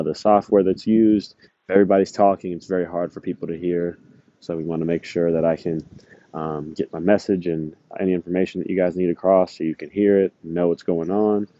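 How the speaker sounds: normal talking voice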